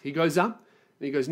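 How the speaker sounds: ordinary delivery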